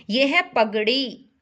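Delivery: ordinary talk, nothing marked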